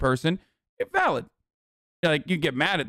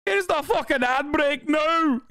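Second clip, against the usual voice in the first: Scottish ad voice; 10 semitones higher, slower than usual. Pretend. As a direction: bellow the ad break in a mock Scottish accent. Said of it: "This is Scottish".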